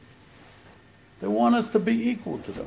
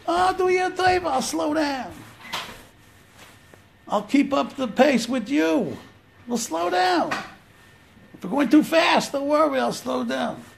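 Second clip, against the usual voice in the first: much higher, sugary mock-friendly voice; Esav's fake kindness; 8 semitones higher than usual. Esav offering Yaakov a favor, high mock-sweet voice. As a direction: coo it like a sly false friend. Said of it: "He says".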